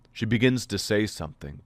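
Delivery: plain reading voice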